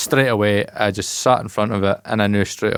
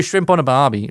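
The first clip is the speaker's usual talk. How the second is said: put-on Aussie accent, pitched up; 4 semitones higher than usual. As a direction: warm up with a mock Aussie accent. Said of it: "to do a good Aussie accent".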